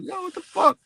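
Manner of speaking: high-pitched, incredulous outburst; startled reaction. Retold or imitated. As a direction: retell it as a squeaky, shocked reaction. Reacting to people's startling revelations.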